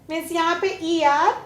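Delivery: ordinary delivery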